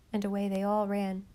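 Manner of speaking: ordinary delivery, nothing marked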